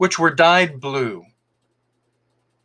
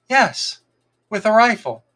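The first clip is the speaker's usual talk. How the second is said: put-on fox boy voice; 8 semitones higher than usual. A narrator's reading.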